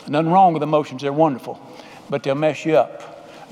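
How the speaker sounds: ordinary delivery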